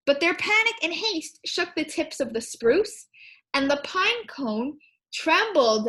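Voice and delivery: put-on lively read-aloud to children; story reading. Teacher reads rhyming book to her class, lively. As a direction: bounce the rhyme for a class.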